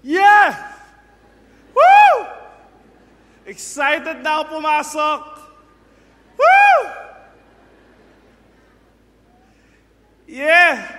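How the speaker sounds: very high, loud whoops of joy; hammed-up thrill at heading off to work; mock excited worker. Preacher acts out an eager worker throwing off the blanket, whooping. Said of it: "Yung talagang pagtapon mo".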